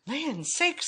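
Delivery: high mama bear exclamation; middle-sized Mama Bear voice. A narrator's reading. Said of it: "said Mama in her middle-sized voice".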